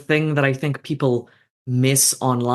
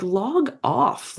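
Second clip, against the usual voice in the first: exasperated retort; 11 semitones higher than usual. Retold or imitated. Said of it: "and you're like".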